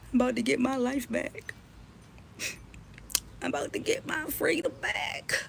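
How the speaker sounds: mock sobbing imitation of him; fake-cry it, laughing through; hammed-up tearful imitation. Mocking his tearful video.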